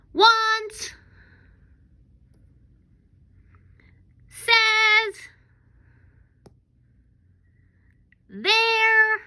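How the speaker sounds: high, loud held-out yell. Shown as a demonstration. Teacher leading kids to yell out sight words, yelling. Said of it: "you yell it out".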